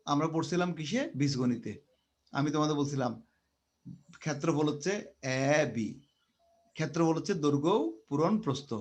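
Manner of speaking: ordinary delivery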